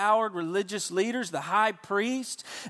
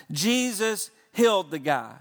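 ordinary talk, nothing marked

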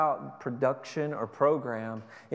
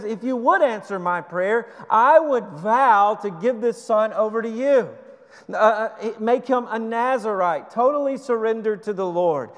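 higher, louder desperate prayer; a mother begging God for a son, higher and louder; Hannah pleading; 6 semitones higher, 6 dB louder than usual. Acted. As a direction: cry out to God as a barren woman with nowhere else to turn. Hannah's vow voiced.

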